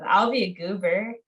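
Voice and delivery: ordinary talk, nothing marked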